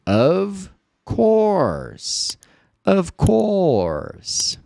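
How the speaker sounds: Of course said high and bright; high modeled phrase. Shown as a demonstration. Teacher leading students to repeat aloud, high. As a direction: lead the repeat, high and clear. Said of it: "fala em voz alta comigo".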